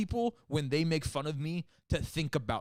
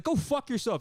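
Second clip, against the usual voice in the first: high-pitched mock angry comeback; imitated yelling, pitched well up; retold road-rage insult; 9 semitones higher, 4 dB louder than usual. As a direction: fire it back like an arguing driver. Retold road-rage shouting match.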